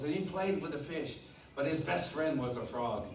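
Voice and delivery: usual speaking voice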